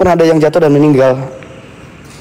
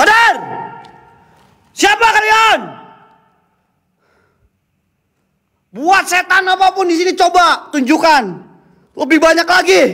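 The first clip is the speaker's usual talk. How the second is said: challenging shout. Acted. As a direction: dare the ghosts to show themselves. Challenging the spirits.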